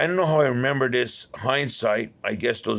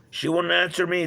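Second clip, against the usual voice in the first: normal talking voice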